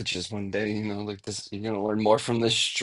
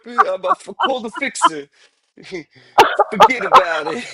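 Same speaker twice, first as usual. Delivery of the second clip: hitman voice; 8 dB louder than usual. Pretend. Sound like a hitman.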